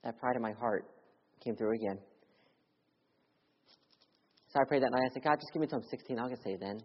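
ordinary talk

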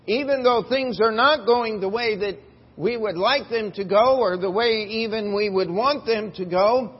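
loud, high, rising preacher's cry; full-force preaching. Acted.

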